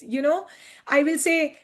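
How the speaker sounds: ordinary delivery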